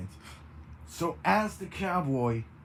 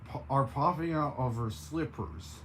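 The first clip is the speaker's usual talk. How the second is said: usual speaking voice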